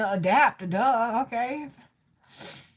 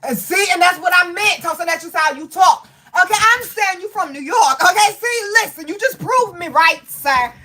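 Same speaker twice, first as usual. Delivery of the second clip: higher, louder, insistent protest; real exasperated bickering; 5 semitones higher, 5 dB louder than usual. Real, not acted. Medium telling off a spirit over how he talks, exasperated. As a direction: argue back loudly, riled up.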